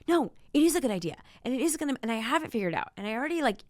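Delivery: loud, high-pitched insistence; defensive. Acted. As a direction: argue back hotly, acting out self-justification. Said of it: "like, make you defensive".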